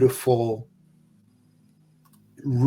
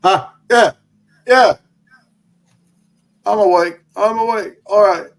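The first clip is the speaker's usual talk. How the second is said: much higher and louder, jolting awake; startled awake; 9 semitones higher, 9 dB louder than usual. Acted. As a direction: sputter awake in a high, loud little voice. Toro jolted awake, groggy.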